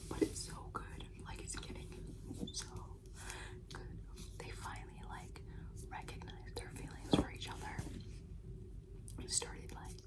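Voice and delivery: hushed, breathy midnight whisper; late-night whisper. Real, not acted. Confiding to viewers about a book at midnight, whispered. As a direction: whisper so nobody in the house wakes up.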